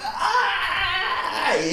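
high, strained cry for help from across the lake; pained shout from the swamp lake; distant guy yelling. Acted. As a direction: yell "Ah!" like a man stranded far off. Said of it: "fyr der og råber".

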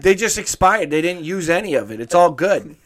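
high, loud cartoon Boston rat, laughing; Boston rat accent. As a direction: yell it like a gleeful Boston rat. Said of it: "they all have a Boston accent".

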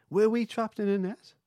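high, loud disbelief; surprised. Real, not acted. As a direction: blurt it out in shock. Shocked question at the story.

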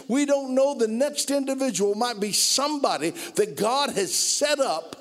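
preacher hitting the sermon's climax; preaching at full force. Acted. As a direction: lift the congregation, stretch every word.

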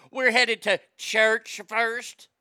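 loud, slow mock Southern drawl; pointed Southern announcement. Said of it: "she made sure everybody knew she was headed to church".

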